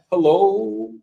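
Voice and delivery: high, sing-song mock hello; mock spirit greeting. Spirits just wanting to say hi, high mock voice.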